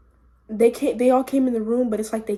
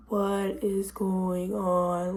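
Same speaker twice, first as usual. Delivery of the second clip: slow, low, softer stoned reaction; dazed; 3 semitones lower, 5 dB quieter, much slower than usual. Retold or imitated. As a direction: drag the words out, dazed and quiet. Retold dazed reaction while high.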